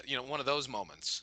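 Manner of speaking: ordinary talk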